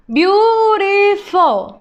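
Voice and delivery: slow modelling voice; slow syllable-by-syllable. Shown as a demonstration. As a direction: sound out beautiful for the class. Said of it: "teacher models word then slows".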